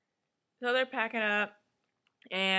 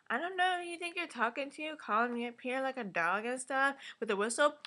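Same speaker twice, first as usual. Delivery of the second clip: high-pitched, fast mock of Asha; weak Asha imitation; 8 semitones higher, faster than usual. Mimicking Asha's failed attempt to go off, high-pitched mockery. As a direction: squeal out an ineffective telling-off, mockingly. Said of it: "that's, like, not what you do".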